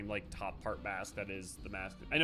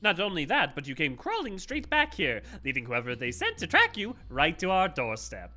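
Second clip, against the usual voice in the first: sharp, raised accusing voice; accusing game character; 6 semitones higher, 5 dB louder than usual. Acted. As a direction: confront them like a suspicious boss. Voicing a cutscene character.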